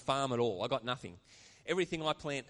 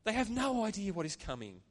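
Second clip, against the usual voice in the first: fighting back tears as the pitch climbs; moved, pitch high and straining; emotional; 6 semitones higher than usual. Real, not acted. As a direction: voice rising with emotion, close to tears. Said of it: "I actually started to get emotional and tears welling up".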